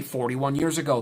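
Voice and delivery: normal talking voice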